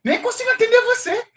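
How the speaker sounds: high, fast puzzled voice of a confused listener; mock confused questioner. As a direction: squeak out the confusion of someone who can't follow. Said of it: "as pessoas me perguntam".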